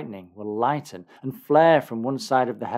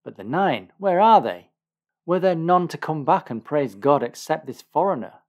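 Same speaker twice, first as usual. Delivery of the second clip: raised, forceful questioning; louder, higher rhetorical exclamation; exclaiming; 5 semitones higher, 7 dB louder than usual. A narrator's reading. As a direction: demand to know where they are. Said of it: "exclaimed Jesus".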